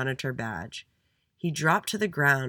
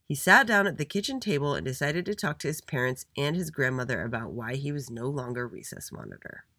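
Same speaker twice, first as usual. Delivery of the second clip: ordinary delivery, nothing marked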